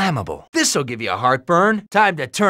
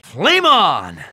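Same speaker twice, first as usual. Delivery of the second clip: loud, long-held triumphant call; battle cry; 4 dB louder than usual. Acted. Hero igniting his fire webbing, triumphant battle cry. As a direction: shout it out like a superhero's catchphrase.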